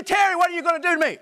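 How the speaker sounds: high, loud mock-angry yell; demonstration yell. Shown as a demonstration. Preacher shows Terry how anger provokes anger, mock yell. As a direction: yell it as a quick, high challenge. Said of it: "If I yell at you".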